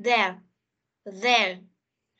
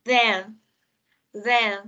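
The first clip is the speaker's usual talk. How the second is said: American there repeated; 3 semitones lower, 3 dB louder than usual. Shown as a demonstration. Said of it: "theo cách đọc Anh Mỹ".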